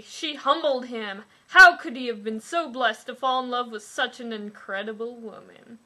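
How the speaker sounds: normal talking voice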